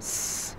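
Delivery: a long hissed 's' for স; স ধ্বনি দেখানো. Shown as a demonstration. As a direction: hold স as a pure sibilant. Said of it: "এইভাবে উচ্চারণ করবা". (স টেনে শিসধ্বনি)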